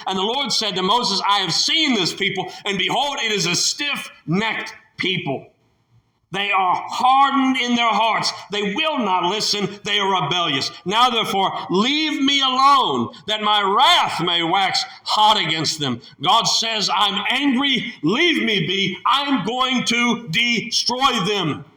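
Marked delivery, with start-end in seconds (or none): 1.3-16.0 angry God. Acted. God telling Moses to leave him alone, rising wrath. 16.9-21.6 high, slow, heavy threat; angry God. Acted. God warning Moses he will destroy them, slow and angry.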